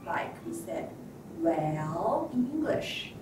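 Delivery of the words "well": "well" drawn out, dipping then climbing; falling-rising tone. Shown as a demonstration. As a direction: dip down and back up on "well". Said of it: "a falling and rising tone".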